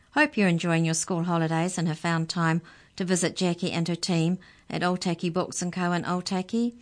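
put-on bright, lively sign-off to kids; children's radio host. Host signs off to boys and girls, lively. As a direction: chat warmly to young listeners. Host addresses young listeners.

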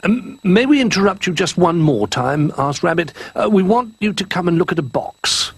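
Rabbit voice. Acted. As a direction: pitch up and lean in eagerly.

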